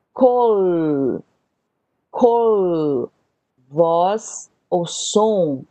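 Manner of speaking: slow call and repeat, long pauses between the words; repeat after me drill. Shown as a demonstration. Teacher leading students to repeat Hebrew words, slowly. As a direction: model each word for the class to echo. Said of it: "repitam comigo".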